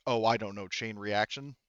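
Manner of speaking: normal talking voice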